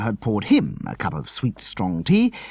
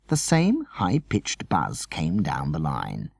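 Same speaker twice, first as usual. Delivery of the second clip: ordinary delivery, nothing marked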